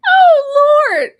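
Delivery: high, loud, wheezing out of laughter; breathless laughter. Real, not acted. Host catching breath after laughing hard, high and loud. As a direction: gasp it out still laughing.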